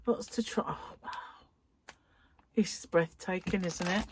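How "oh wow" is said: soft whisper of delight; awed whisper. Real, not acted. Crafter admiring new yarn, whispering in awe. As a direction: breathe it out like a secret.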